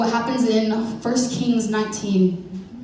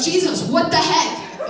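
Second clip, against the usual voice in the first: high, laughing mock panic in the storm; panicked disciples; 8 semitones higher, slower than usual. Disciples in the storm yelling at Jesus, laughing mock panic. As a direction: squeal it out like a scared disciple. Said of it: "Just kidding, I didn't say that".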